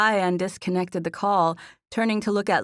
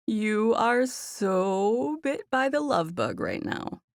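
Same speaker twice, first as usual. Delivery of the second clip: slow, higher singsong tease; teasing; 5 semitones higher, much slower than usual. A narrator's reading. Friend needles her smitten friend, teasing.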